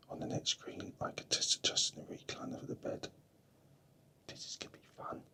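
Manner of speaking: hushed whisper so sleepers aren't woken. Real, not acted. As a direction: keep it to a soft breathy whisper. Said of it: "I'm whispering because people might be asleep".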